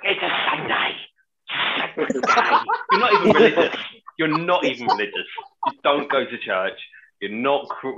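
high, loud indignant mum, easing slightly; mock-outraged mum telling off a swearer; scolding mum impression. Acting out mum's Sunday outburst.

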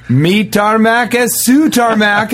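high, slow mangled Spanish greeting; mock Spanish host. Greeting a friend at his place in mock Spanish, high and slow. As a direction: welcome a guest in fake Spanish. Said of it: "be like".

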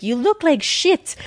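a blunt French woman, pitched well up, imitated by the teller; French accent. A French psychic friend greets her at the door, imitating a French accent. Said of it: "She's this French woman".

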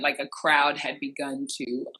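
ordinary talk, nothing marked

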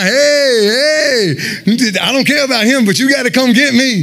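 high-pitched imitated hailing of passing boats; calling to boats. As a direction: act out flagging down help. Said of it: "I'm flagging boats now".

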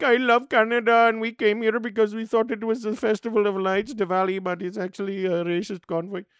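put-on cheerful Indian accent, a bit naive; Indian accent impression. Comic imitating Brampton supporter, Indian accent. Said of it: "Indian people from Brampton being like".

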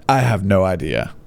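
ordinary talk, nothing marked

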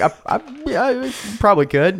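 high, stumbling mock reply; hesitant young self. Student unsure about skipping school, high hesitant mock. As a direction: stammer it out, pitched high. Own hesitant answer voiced.

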